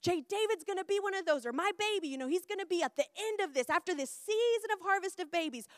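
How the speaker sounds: high, loud, spirit-filled declaration; so excited. Acted. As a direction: declare it to God with bursting excitement. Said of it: "I was so excited".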